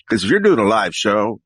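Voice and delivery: usual speaking voice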